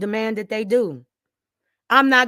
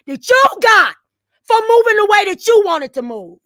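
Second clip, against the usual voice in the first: high, loud accusation; heated, loud outburst; angry yelling; 9 semitones higher, 10 dB louder than usual. Real, not acted. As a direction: raise your voice and accuse.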